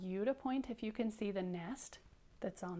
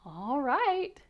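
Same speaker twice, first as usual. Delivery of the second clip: higher, louder drawn-out acceptance; like Missus Bird; 5 semitones higher, 7 dB louder than usual. Shown as a demonstration. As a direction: give in with an exaggerated, lifted "all right". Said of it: "Can you do that like she did".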